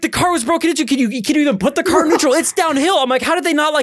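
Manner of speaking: high, fast disbelief; can't work out how they did it; baffled. Retold or imitated. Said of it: "I was like".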